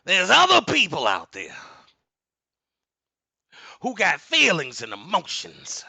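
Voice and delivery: loud, high preacher voice; preaching. Real, not acted. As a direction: preach it to the congregation. Preaching at full force.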